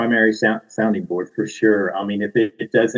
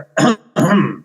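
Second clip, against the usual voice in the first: loud theatrical throat clear; pointed throat clear; 9 semitones higher, 4 dB louder than usual. Acted. Narrator clears throat to demand attention, theatrical throat clear. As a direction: clear your throat to restart.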